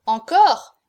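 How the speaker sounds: loud, startled question; exclaimed surprise. A narrator's reading. Both ruffians hear of another young girl, exclaiming. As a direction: cry out in surprise. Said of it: "s'écrièrent à la fois Cocardas et Passepoil".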